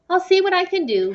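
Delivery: high, loud voice for the teacher. A narrator's reading. Missus Welch answering Mia's request. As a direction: play the teacher, pleased but noncommittal.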